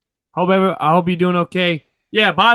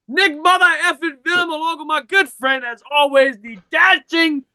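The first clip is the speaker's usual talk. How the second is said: much higher announcer call; ring announcer; 9 semitones higher than usual. Acted.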